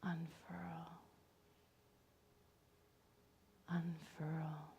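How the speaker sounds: very soft, whispered call. Acted. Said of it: "And still the voice says".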